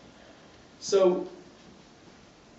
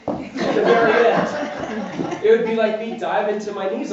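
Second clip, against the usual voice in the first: laughing through it, higher and louder; picture the collapse and chuckle; 5 semitones higher, 5 dB louder, slower than usual. Real, not acted. Describing diving to his knees at the finish, laughing.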